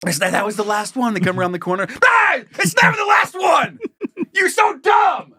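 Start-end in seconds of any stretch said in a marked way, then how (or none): 2.0-5.3 high, loud frantic shouting; high panicked yell. Retold or imitated. Chihuahua yelling at its owner, high frantic shout. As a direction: scream it like a furious tiny dog.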